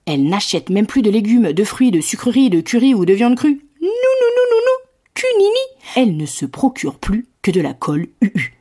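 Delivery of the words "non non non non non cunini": squeaky high refusal. Acted.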